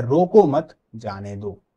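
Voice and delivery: slow, रोको मत together, then जाने दो; group रोको मत, break, then जाने दो; pause after मत. Shown as a demonstration. Teacher reads book example, pausing after मत. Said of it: "यहां पर ये जो कॉमा लगा है".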